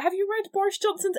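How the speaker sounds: high, pointed rhetorical question; sarcastic retort. Retold or imitated. Answering a naive asker, high and sarcastic. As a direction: fire back with mock disbelief. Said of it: "And it's like".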